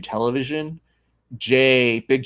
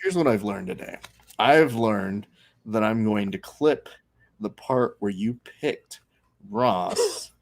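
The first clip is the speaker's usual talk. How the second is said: low, halting, deadpan delivery; deliberate teasing; 3 semitones lower, 4 dB quieter, slower than usual. Real, not acted. Host recaps co-host's bad pick, deliberate and deadpan. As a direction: lay it out piece by piece with pauses.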